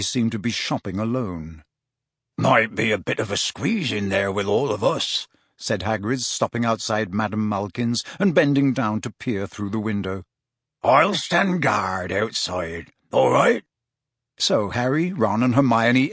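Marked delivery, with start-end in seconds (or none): 2.4-5.3 put-on gruff-accented big friendly character voice; Hagrid. A narrator's reading. Hagrid to the friends at the shop, casual character voice. 10.8-13.6 slow, friendly giant character voice; drawn-out offer, rising on the question; Hagrid. A narrator's reading. Hagrid offering to stand guard, slow character voice.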